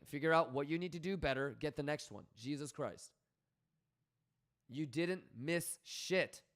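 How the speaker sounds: ordinary delivery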